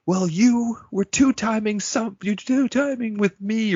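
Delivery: high, loud, slow indignant outburst in an odd voice; furious robot. Retold or imitated. Recapping Bender raging at Angeline, high mock fury. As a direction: bellow the accusation, outraged. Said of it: "Bender is infuriated".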